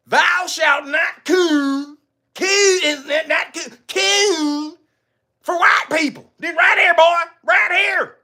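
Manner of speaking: high, drawn-out proclamation; mock commandment. Host reading an invented rule against him, mock-solemn decree. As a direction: hand down the rule like scripture, high and theatrical.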